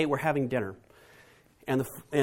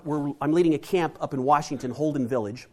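ordinary talk, nothing marked

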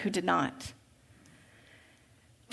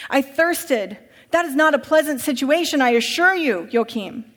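higher, louder, insistent rich master; urgent master; 3 semitones higher, 4 dB louder than usual. Acted. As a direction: hurry him inside, pleading and pressing. Master recounts dream, agitated.

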